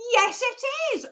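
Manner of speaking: high, loud put-on answering voice; playful attempt at voicing the psychic on the phone; Hazel. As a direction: pitch it way up, mock phone answer. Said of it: "I can't do Hazel's accent".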